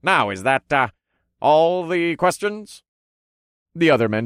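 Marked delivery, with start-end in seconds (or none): 0.0-2.8 lightly voiced ring announcer, drawn-out finish; showman announcer. A narrator's reading. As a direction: play the announcer checking with the crowd. Announcer addresses fighters before matches.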